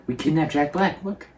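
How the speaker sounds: high, loud mock excitement; proud announcing. Muppets presenting the kidnapped actor to Kermit, proud.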